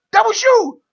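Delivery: high, loud ranting yell; imitated scream. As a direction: scold the ref at full volume. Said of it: "screams at him".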